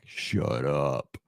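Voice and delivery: low, soft, breathless shut up; stunned disbelief. Retold or imitated. Fan learning the star quarterback is back, low stunned disbelief. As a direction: say it under your breath, floored. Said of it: "I'm like".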